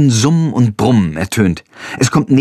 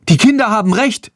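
rufend; 10 semitones higher than usual. A narrator's reading. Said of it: "ruft eine junge Frau".

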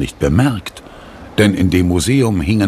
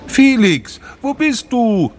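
high, searching call; slower than usual. A narrator's reading. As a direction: call for a missing friend, pitched right up. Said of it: "rief Sophie nun etwas lauter".

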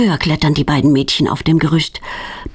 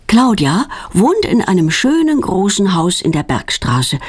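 plain reading voice

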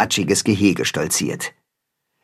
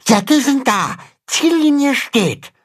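much higher small calf voice; reporting; 14 semitones higher than usual. Acted. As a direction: report it proudly. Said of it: "berichtet Pünktchen".

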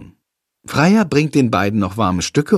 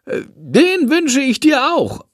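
lightly voiced bat, much higher and slow; 13 semitones higher than usual. A narrator's reading. Bat greets the girl, high slow voice. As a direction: answer with amused politeness.

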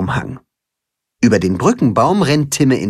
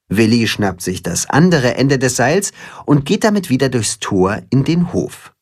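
ordinary delivery, nothing marked

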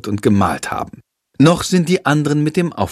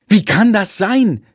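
dismayed; 9 semitones higher, 3 dB louder than usual. Acted. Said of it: "tippt Jendrik".

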